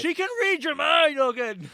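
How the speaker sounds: very high, loud warning; exasperated shout. Pretend. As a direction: yell it at someone being an idiot. Shouted warning to character Logan.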